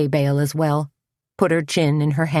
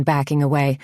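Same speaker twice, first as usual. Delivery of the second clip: plain reading voice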